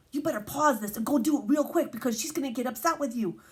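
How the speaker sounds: ordinary delivery, nothing marked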